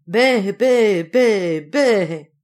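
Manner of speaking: loud, drawn-out imitated bleating; storyteller's forceful goat cries. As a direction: bleat loudly like a complaining goat. Wicked goat bleats its complaint.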